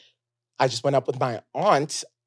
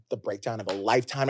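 ordinary talk

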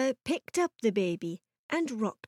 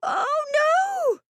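high, drawn-out wail of alarm; frantic storybook mother's cry; mother's yell; 4 dB louder than usual. A narrator's reading. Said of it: "yelled the mother".

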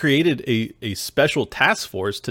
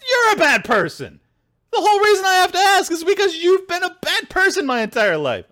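much higher, louder, furious shout back; angry retort; 4 dB louder than usual. Retold or imitated. Yelling back at people who called him bad, furious. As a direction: throw it right back at them, yelling. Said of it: "I'm like".

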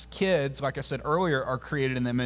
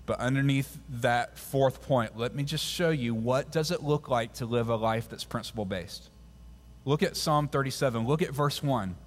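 usual speaking voice

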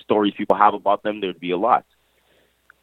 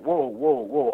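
high, alarmed whoas; reliving the moment the cops accused him; startled whoa; 6 dB quieter, much slower than usual. Retold or imitated. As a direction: pull back in high-pitched alarm. Retold alarm at police.

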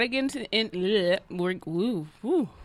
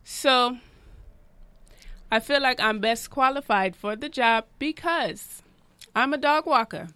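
put-on Jamaican accent. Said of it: "you have a Jamaican accent".

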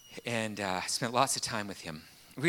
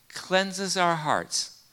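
drawn-out emphasis; 8 semitones higher, 6 dB louder, much slower than usual. Real, not acted. Slow emphatic sermon point.

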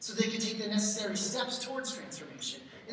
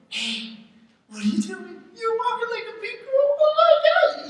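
much higher, louder, rising squeal of delight; gushing baby talk; 9 dB louder than usual. Retold or imitated. Parent gushing at a little girl walking, high squealing baby talk. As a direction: gush over a toddler's first steps. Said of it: "that's why you have baby talk".

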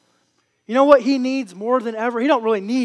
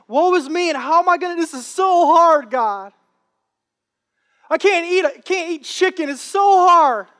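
high, mock-whining fasting complainer; whiny complaining flesh; 7 semitones higher, 4 dB louder than usual. Preacher mocks a fasting believer's whining, high and hammed-up. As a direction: whine like a hungry baby. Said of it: "your flesh is squealing and crying and complaining".

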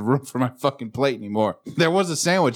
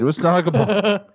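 high mock answer; 8 semitones higher, 4 dB louder than usual. Echoing the joke word.

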